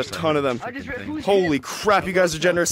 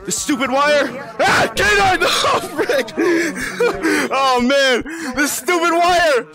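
frustrated shouting; 6 semitones higher, slower than usual. Real, not acted. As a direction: yell at the stupid wire. Getting snagged in game.

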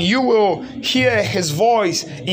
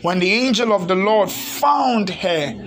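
ordinary talk, nothing marked

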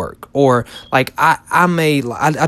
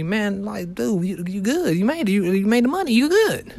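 higher, softer laid-back "you good" voice, rising to the end; mock a casual friend shrugging it off; lightly voiced chill buddy; average person's brush-off; 5 semitones higher, 6 dB quieter than usual. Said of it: "the average person is gonna tell me".

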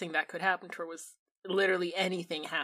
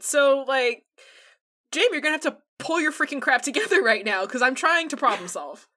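angry; 2 semitones higher, 8 dB louder than usual. Acted. Heated argument, accused of murder.

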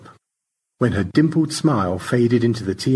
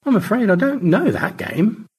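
put-on a much higher genteel old voice; gracious high thanks; George; 12 semitones higher than usual. George thanks both rescuers, genteel high voice.

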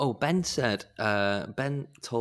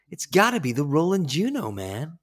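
lightly voiced pushy salesman, a bit higher; 6 semitones higher, 3 dB louder than usual. Mocking music shop salesman recommending a synth, mildly hammed. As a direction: push the product with easy confidence.

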